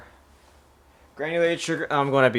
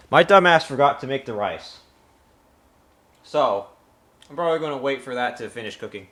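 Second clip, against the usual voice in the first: ordinary delivery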